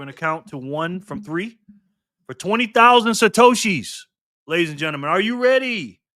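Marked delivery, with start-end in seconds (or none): 2.3-6.0 loud, high game-show announcer build-up; hyped countdown. Real, not acted. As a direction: rev up the crowd, rising to the spin. Hyped countdown to the final spin.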